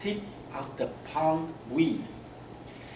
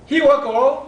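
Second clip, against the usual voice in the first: put-on little girl's voice; 10 semitones higher, 9 dB louder than usual. Little girl answers her mother.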